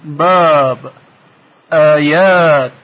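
"باب" and "آیات" with the aa held long; long open aa. Shown as a demonstration. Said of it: "صدای کشیدهٔ بالا وجود داره". (کشیدن صدای آ)

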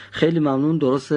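ordinary delivery

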